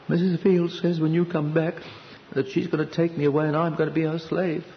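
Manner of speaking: a hint of a frightened six-year-old telling his father; child's voice. Boy telling his father about the housekeeper, lightly childlike.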